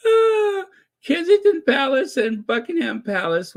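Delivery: high, squeaky tail of a laughing fit; coming out of laughter. Real, not acted. Predicting the palaces' copying, still recovering from laughter. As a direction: start high, settle as the laugh fades.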